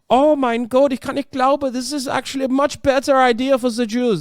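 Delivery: high-pitched hammed-up German accent; mock German, pitched well up. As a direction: do an excited caricature German accent. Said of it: "when I did that accent".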